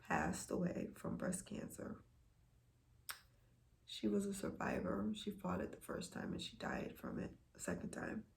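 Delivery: hushed, grieving and real; emotional. Real, not acted. Sharing news of a death from breast cancer, emotional. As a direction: let the voice fall away with grief. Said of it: "I'm really feeling emotional right now".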